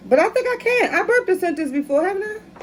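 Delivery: high, giggly and amused; laughing. Real, not acted. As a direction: giggle through the question. Laughing about burping sentences.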